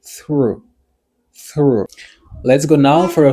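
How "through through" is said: "through" alone, one long falling syllable; pronunciation demo. Shown as a demonstration. Teacher repeating the pronunciation, "through" drawn out. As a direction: model "through" clearly, pausing after it. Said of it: "Mwen di pwononsyasyon se".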